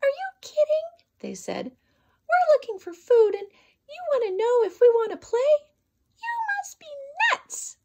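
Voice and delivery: mock the rabbit in shrill disbelief; scoffing birds.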